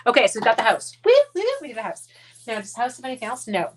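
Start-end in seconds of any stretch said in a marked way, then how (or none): 1.0-2.1 high, fast, louder cheering; excited cheer. Real, not acted. Cheering to the viewers about the finished house, excited. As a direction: celebrate the little win.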